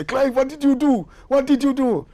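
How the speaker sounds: alarmed. Retold or imitated. The French guy panics at Clive, high and alarmed. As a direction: fret about the trouble. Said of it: "the guy say".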